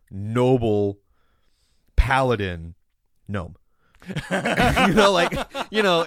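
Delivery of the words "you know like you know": high laughing aside. Real, not acted. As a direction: laugh through the words. Laughing at gnome paladin idea.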